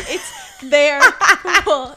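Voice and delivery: "their" loudly said "thair", through laughter; corrected THEIR. Retold or imitated. Mimicking Sam's correction, loud laughing "thair" for "their". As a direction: laughingly overdo the "air" in "their". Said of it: "And he's like".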